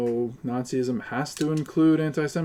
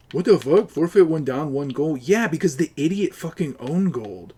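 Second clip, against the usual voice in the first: higher, louder, outraged; furious outburst; 4 semitones higher, 5 dB louder than usual. Real, not acted. Gamer erupting at a teammate's own goal, outraged shout. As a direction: explode at the blunder.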